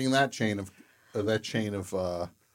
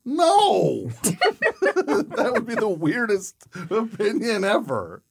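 loud, high laughing outburst; 13 semitones higher, 9 dB louder than usual. Real, not acted. Host laughs off his own fake opinion, loud and high. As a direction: burst out laughing, much higher and louder.

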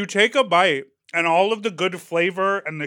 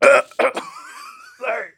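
laughing; 4 dB louder than usual. Real, not acted. Coughing fit on hot sauce.

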